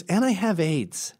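high, slow affected announcement. Retold or imitated.

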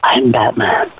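low whispered Batman rasp. Acted. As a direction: breathe it like a brooding hero. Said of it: "Give us one more".

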